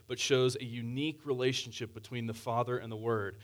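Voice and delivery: usual speaking voice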